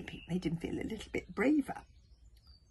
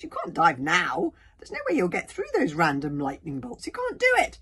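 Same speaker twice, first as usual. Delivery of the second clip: panicked; 10 dB louder than usual. A narrator's reading. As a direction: plead in alarm against the dive. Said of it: "panicked Fishlegs".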